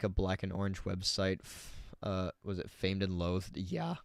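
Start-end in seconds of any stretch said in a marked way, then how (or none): 3.6-3.9 voice crack. Real, not acted. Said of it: "Sorry for the voice crack".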